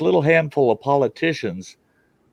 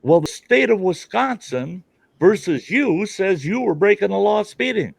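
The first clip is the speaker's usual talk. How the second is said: cop voice; 8 semitones higher than usual. Retold or imitated. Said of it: "retold cop reply".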